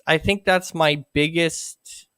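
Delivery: ordinary talk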